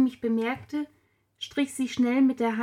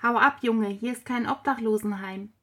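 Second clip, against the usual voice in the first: ordinary delivery, nothing marked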